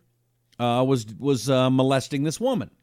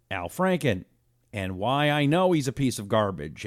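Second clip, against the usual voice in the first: ordinary talk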